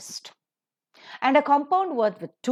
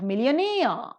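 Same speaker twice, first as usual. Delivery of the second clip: millionaire with -aire lifted and stretched; millionaire, long accented last syllable; stress on final syllable; 3 semitones higher, slower than usual. Shown as a demonstration. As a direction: lean on the end of millionaire. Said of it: "the second syllable is accented".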